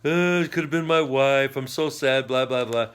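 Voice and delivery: hammed-up sob-story whine, mildly done; mock crying. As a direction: imitate weepy men dismissively. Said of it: "are all crying and said".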